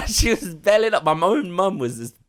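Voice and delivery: words squeezed out through laughter; laughing. Real, not acted. Telling friend how his mum laughed at him.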